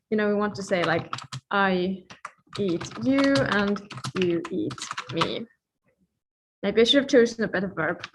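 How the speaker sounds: usual speaking voice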